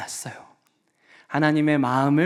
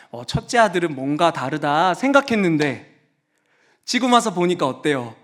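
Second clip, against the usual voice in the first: ordinary delivery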